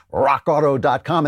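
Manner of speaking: "rock auto dot com" with ROCK rolled out dramatically; showy brand-name delivery. Shown as a demonstration. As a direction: relish "rock auto dot com", drawing out the first word. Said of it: "so cool to say".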